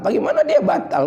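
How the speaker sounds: how could it be?; incredulous. Acted. Rhetorical question to congregation. (heran, nada tinggi)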